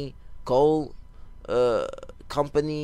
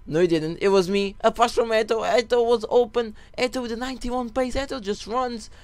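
getting excited; 9 semitones higher than usual. Real, not acted. Gamer reliving his goal to viewers, excited and high. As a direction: bubble up, the story of your goal takes over.

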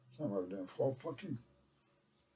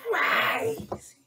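shrieked much higher and louder; high shout; 6 dB louder than usual. Pretend. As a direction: yelp it out.

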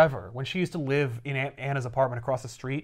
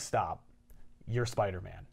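flat interruption; 7 semitones lower, 9 dB quieter than usual. Retold or imitated. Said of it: "she's like".

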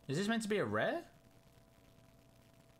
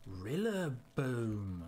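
ordinary talk

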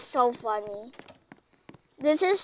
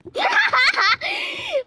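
very high squealing laugh; gleeful cackle; 4 dB louder than usual. Real, not acted. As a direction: let out a high burst of laughter. Laughing after a sneaky kill.

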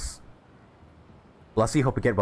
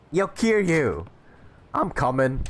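calling out; 7 semitones higher, slower than usual. Acted. Friend calling out.

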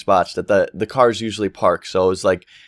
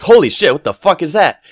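loud, high shock at something huge; retold gasp of surprise; startled; 5 semitones higher, 5 dB louder than usual. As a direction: blurt it out in alarm. Sees a huge animal in yard.

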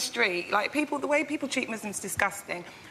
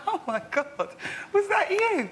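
mock shocked; 8 semitones higher than usual. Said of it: "I was like".

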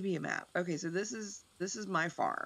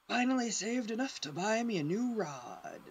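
a light put-on old fisherman voice, a bit higher; 7 semitones higher than usual. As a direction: try the salty fisherman voice half-jokingly. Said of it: "the fisherman voice".

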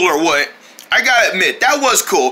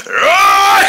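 battle yell; 11 semitones higher, 4 dB louder than usual. Acted. Fight starts.

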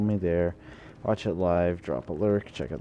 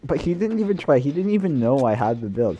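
frustrated rant; 5 semitones higher, 6 dB louder than usual. Real, not acted. Venting about killer.

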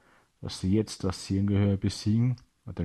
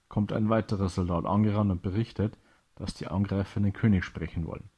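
plain reading voice

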